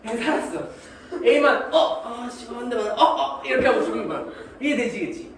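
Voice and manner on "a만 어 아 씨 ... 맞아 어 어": hit cries. Acted. Soldier hit by bullets, acted.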